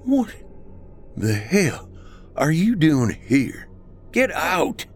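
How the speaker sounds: put-on slurred, high drunken shout; town drunk. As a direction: slur it out like a drunk man. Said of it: "Woodward slurred his words".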